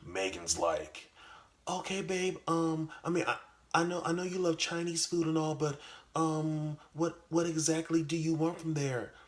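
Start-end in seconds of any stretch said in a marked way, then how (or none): 1.7-9.1 put-on much higher, sweet girlfriend voice. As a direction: answer him like a sweet girlfriend.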